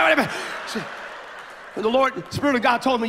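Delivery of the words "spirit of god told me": normal talking voice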